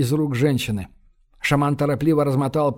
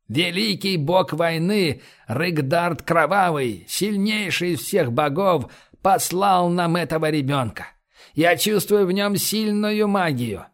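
booming biblical narration; 3 semitones higher than usual. Acted. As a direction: preach it like a shaman prophet. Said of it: "торжественным голосом".

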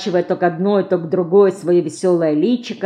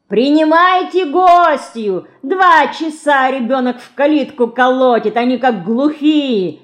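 high, loud shout across the garden; calling out; 7 semitones higher, 5 dB louder than usual. A narrator's reading. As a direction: call out in exasperation to people indoors. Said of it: "крикнула из сада Лина".

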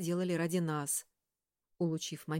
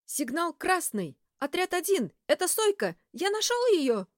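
falsetto shout; 11 semitones higher, 5 dB louder than usual. A narrator's reading. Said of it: "закричал неизвестный в конце, перейдя на фальцет".

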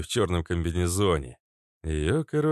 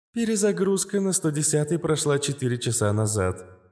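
higher, even machine-like AI; calm AI voice; 9 semitones higher than usual. A narrator's reading. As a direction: explain it like a calm AI. Astra reports.

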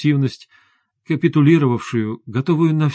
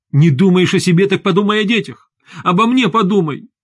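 high, pressing appeal; upset wife demanding to be considered; pleading reproach; 6 semitones higher, 3 dB louder than usual. A narrator's reading. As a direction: reproach him, voice pitched up. Wife pleads with departing husband.

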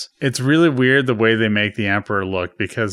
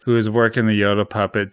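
ordinary talk, nothing marked

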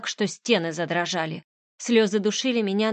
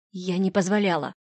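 usual speaking voice

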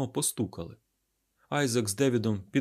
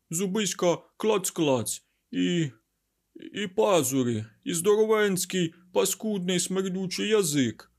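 put-on childlike frightened outpouring; 9 semitones higher, 6 dB louder than usual. A narrator's reading. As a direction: pour it out like a scared child, pitched high. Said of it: "мов дитина, він вигорнув усе гамузом".

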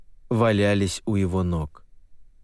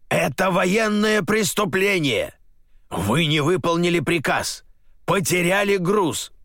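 furious shouting; 9 semitones higher than usual. Acted. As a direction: bellow the accusations. General raging at officer.

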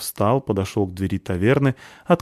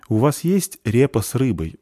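timid, higher-pitched question; 4 semitones higher, 4 dB louder than usual. A narrator's reading. Girl orders from the waitress, timid. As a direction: ask shyly, unsure of the answer. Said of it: "робко спросила девушка".

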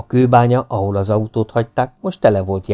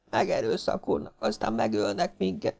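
soft, panicky whimpering; frightened lamenting; 9 semitones higher, 11 dB quieter than usual. A narrator's reading. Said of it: "siránkozott most már hangosabban Mrs. Farmington".

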